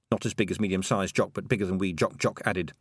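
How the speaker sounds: usual speaking voice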